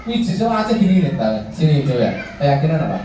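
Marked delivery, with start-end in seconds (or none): 0.0-1.1 higher, fussy parent's complaint; complaining parent. Retold or imitated. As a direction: mimic the parent reporting her child. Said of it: "orang ucapnya". (meniru orang tua yang mengeluh, nada lebih tinggi)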